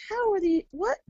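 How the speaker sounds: high, bewildered mock exclamation; retold disbelief. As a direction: replay the baffled question at the rocks. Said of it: "And I'm like".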